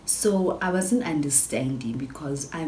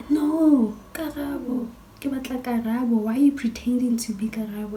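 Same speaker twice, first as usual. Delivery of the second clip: high, loud mock-distraught insistence; imitated crying protest; 8 semitones higher, 6 dB louder than usual. Crying friend accuses her of pretending to be Karabo, high mock wail. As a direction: protest like an upset friend, pitched way up. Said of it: "Becky starts crying".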